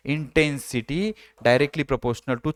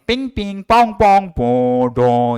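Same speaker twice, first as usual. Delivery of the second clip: honking traffic horns; 6 dB louder, slower than usual. Shown as a demonstration. Teacher imitates heavy traffic horns for a seventy dB sound. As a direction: beep it out like horns in a traffic jam. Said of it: "heavy traffic".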